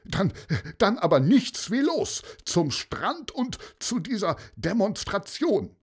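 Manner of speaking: mayor suddenly eager to reach the protest; wide-awake excitement. Acted. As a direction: rush everyone out the door. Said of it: "hellwach und aufgeregt".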